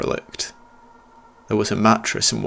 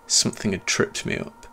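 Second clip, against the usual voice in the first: ordinary delivery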